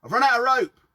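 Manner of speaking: calling up from below. Acted. Mickey down the well shouting up to Phil, loud call. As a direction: yell it up from the bottom of the well.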